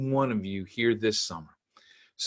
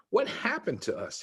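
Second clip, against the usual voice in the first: put-on young boy voice; 10 semitones higher, 3 dB quieter than usual. Jack voiced by daughter.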